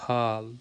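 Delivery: ہال with a long double a, haal; lengthened vowel. Shown as a demonstration. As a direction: stretch the a in ہال. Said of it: "اے کو تھوڑا سا جو ہے وہ لمبا کرنا ہے".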